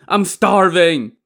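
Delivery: high, loud, drawn-out complaint; shouting. A narrator's reading. Li Kui, starving, cries to his brother, shouting. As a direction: wail it out slowly and loudly. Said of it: "Li Kui shouted".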